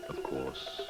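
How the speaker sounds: ordinary delivery, nothing marked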